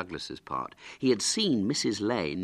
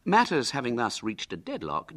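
usual speaking voice

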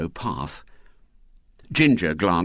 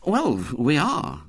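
slow, higher, matter-of-fact admission; simple, mildly frank admission; 8 semitones higher, slower than usual. A narrator's reading. Boy owning up to being scared, said simply. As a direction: admit it simply and slowly. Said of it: "said Henry simply".

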